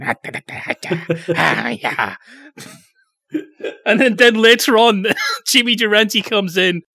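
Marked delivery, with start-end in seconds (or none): 0.0-2.2 scat like a big-nosed piano entertainer; low mock vaudeville vamping. Imitating the guy at the piano, comic scatting impression. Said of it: "playing the piano going".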